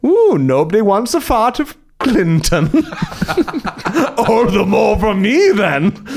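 high, hammed-up Trump voice with a giggle; mock Trump. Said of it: "Donald Trump will say".

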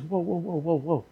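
very soft string of interjections; hushed, acted calming interruption; gentle halting. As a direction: quietly rein someone in, under the breath. Jesus checks the boasting disciple.

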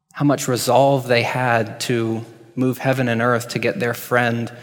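ordinary delivery

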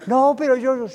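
high, loud, flustered objection; agitated protest. Acted. Discouraged believer answering the one calming them, agitated. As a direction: cut in, refusing to be calmed. Agitated reply to being calmed.

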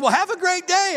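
high, loud, peppy send-off; excited store worker. Retold or imitated. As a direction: imitate an overeager employee seeing off a customer. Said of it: "they were excited about what they were doing".

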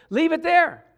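high, loud, firm; preacher commanding sin be left; emphatic command. Real, not acted. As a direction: tell them to leave it, hard.

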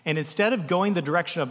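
normal talking voice

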